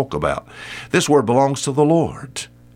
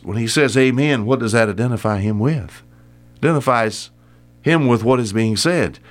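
ordinary delivery

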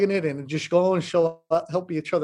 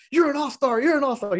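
imitating a pumped-up friend, pitched higher; fired-up hype; 6 semitones higher than usual. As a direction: hype him up like a buddy cheering. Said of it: "Nick Valencia gets all fired up".

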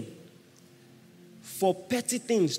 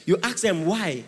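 ordinary talk, nothing marked

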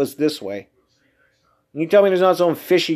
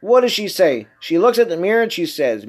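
ordinary talk, nothing marked

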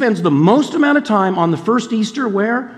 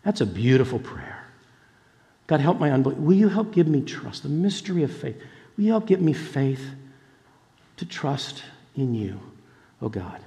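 soft, fading, pleading prayer; quiet prayer; 3 semitones lower, 6 dB quieter than usual. Retold or imitated. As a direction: pray it under your breath. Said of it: "I began to pray for people".

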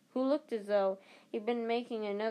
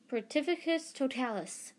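cried spell; 5 semitones higher than usual. Acted. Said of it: "she cried".

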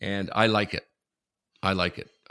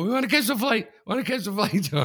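frantic rush; 4 dB louder than usual. Retold or imitated. Rushing to airline desk.

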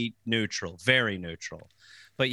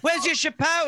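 high, mocking schoolkid voice; high sneering kid taunt; mocking bullies; 12 semitones higher than usual. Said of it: "They're like".

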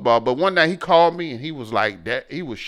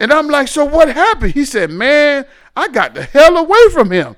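excited retelling; 10 semitones higher, 6 dB louder than usual. Retold or imitated. Retold tense call with son.

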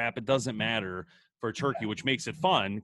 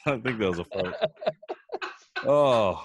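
laughing. Real, not acted. Laughing at a failed call.

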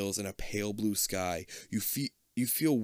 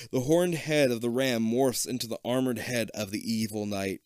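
ordinary talk

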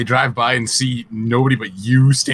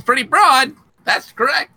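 mock roast; 12 semitones higher, slower than usual.

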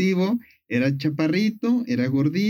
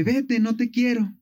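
lightly voiced curt brush-off, higher; rejecting Narcissus; 7 semitones higher than usual. Narcissus rejects the nymph Echo, a light higher brush-off. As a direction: push her away with a light mock rejection. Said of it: "Y le dice".

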